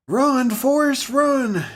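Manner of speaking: high, slow, drawn-out cheer; high stretched-out mock cheer; chat cheering. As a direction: cheer him on like the movie. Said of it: "That was the chat there".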